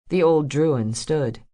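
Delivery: ordinary delivery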